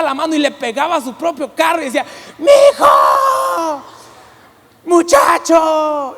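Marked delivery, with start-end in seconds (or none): 2.4-6.2 loud high mock yell of an angry driver lady; lady in the next car yelling at the pastor, high shout. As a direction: imitate her shouting out the window, high and drawn out.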